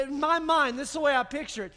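normal talking voice

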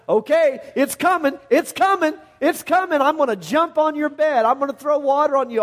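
high, rising, playful threat; hammed-up parent waking kid. Parent threatening a sleeping kid on a school morning, high and playful.

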